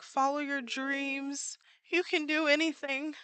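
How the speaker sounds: high, slow, rousing pep talk; upbeat anime hero cheer. Retold or imitated. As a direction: lift the words like a shonen hero urging you on. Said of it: "'cause they're just like".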